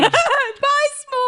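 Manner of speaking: high, bright singsong goodbye; sending off the doomed horse, playful; mock farewell. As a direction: wave the horse off cheerily.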